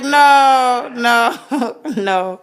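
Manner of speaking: long drawn-out no, high and loud, easing down; playful protest breaking into a laugh; mock refusal. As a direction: refuse with amused exasperation. Acted refusal to supportive partner role.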